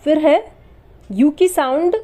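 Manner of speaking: normal talking voice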